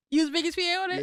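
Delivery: surprised. Real, not acted. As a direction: react in amazed disbelief. Amazed at guest's revelation.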